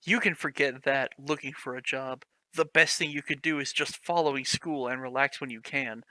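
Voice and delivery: ordinary talk, nothing marked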